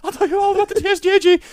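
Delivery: very high, loud, fast squealing; frantic hammed-up shriek; mock panicked confession. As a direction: blurt it out like a terrified captive. Acted captive begging to confess.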